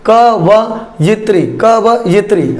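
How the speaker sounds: drawn out slowly, syllable by syllable; slow careful spelling-out. Shown as a demonstration. Teacher to exam students, slow and deliberate. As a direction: stretch कवयित्री slowly so each sound is clear. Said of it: "ध्यान से देख लीजिए".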